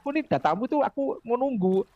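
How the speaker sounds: ordinary talk, nothing marked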